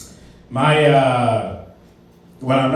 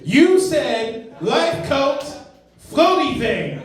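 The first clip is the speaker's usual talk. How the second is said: mocking callback; 12 semitones higher, much slower than usual. Mimicking friend in every argument.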